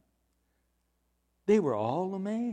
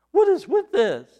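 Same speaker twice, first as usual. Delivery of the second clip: high, louder astonished question; baffled amazement; 10 semitones higher, 5 dB louder than usual. Acted. Crowd baffled by a demon obeying, astonished question. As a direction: ask it high and incredulous.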